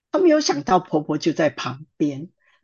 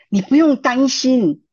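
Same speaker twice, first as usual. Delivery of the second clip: higher, louder reassurance; reassuring; 6 semitones higher, 6 dB louder than usual. Retold or imitated. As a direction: lift her out of worry. Pastor reassuring her.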